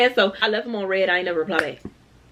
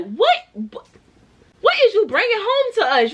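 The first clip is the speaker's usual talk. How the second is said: high, slow mock-outraged relatives; family's shocked question; 12 semitones higher, 3 dB louder, slower than usual. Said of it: "these people gonna be like".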